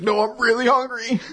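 high, whiny put-on protest; hammed-up high character voice; mock insistence. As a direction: squeal it as an exaggerated protest. Voicing character insisting she's hungry.

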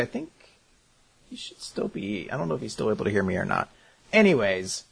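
ordinary talk, nothing marked

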